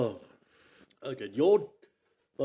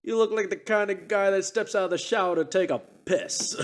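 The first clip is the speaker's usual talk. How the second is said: Dice Man voice; 7 semitones higher than usual. Retold or imitated. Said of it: "he had some good ones".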